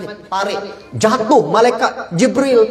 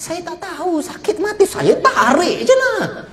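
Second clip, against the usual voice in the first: whining plea; 10 semitones higher than usual. Acted. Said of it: "angel answers in pain".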